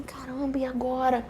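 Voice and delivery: soft retold worry to herself; hushed dismay. Woman alone with a positive test, hushed dismay. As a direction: wonder it under your breath.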